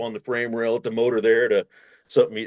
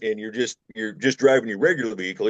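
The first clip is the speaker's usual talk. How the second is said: ordinary talk, nothing marked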